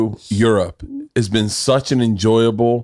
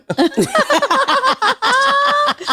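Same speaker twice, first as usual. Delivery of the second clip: long, very high burst of laughter; high shriek of laughter. Real, not acted. Huge laugh after quote.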